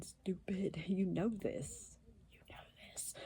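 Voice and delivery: soft, slow hushed dig; whispered scold. Real, not acted. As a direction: whisper the insult under your breath. Witch's aside scolding him.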